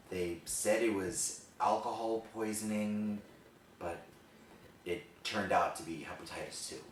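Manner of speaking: hushed, halting monologue with long pauses; subdued, heavy storytelling, pausing between phrases; dramatic confessional monologue. Acted. Performs the rehearsed monologue.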